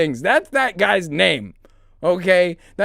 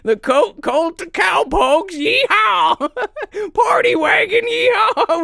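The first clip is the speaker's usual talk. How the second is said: cowboy yeehaw; 12 semitones higher, slower than usual. Acted. Putting on a cowboy hat, hollering at the stream, excited. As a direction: whoop it up like a rodeo cowboy.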